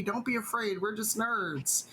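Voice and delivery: normal talking voice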